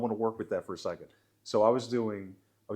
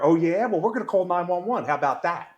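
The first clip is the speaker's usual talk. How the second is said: louder, higher, furious comeback; angry retort; 8 semitones higher, 9 dB louder than usual. Retold or imitated. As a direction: snap back at the heckler. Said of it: "I was absolutely livid".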